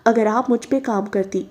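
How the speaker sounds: plain reading voice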